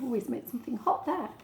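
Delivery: usual speaking voice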